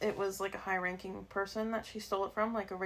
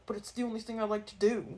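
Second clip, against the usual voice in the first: lightly voiced protesting kid; a hint of a mock plea to dad; imitating son. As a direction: quote a son pleading his case. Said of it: "You sounded just like your dad".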